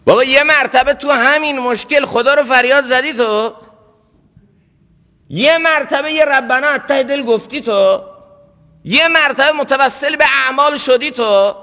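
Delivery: high-pitched, insistent sermon challenge; impassioned reproachful preaching. Real, not acted. Preacher challenging listeners who never called on God, impassioned. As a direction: hammer each question at the congregation.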